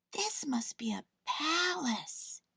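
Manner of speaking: breathless awed whisper, slow; gasped whisper. A narrator's reading. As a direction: gasp it out in wonder. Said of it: "Corduroy gasped".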